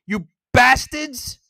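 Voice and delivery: ordinary talk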